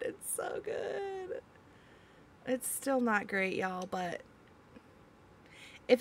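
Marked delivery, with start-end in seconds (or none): 0.0-1.4 high, soft, very slow swoon over food; higher, softer, slower swooning repeat; dreamy savoring. Real, not acted. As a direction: melt into the words as if tasting it.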